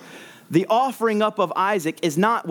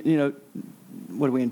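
ordinary talk

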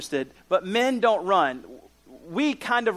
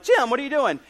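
surprised greeting; 6 semitones higher than usual. Retold or imitated. Running into old roommate Jim.

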